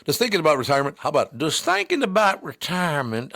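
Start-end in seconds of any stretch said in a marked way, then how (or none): 1.4-3.3 higher, drawled Southern ad read; mock Southern ad read. Shown as a demonstration. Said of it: "if I read the commercial like that".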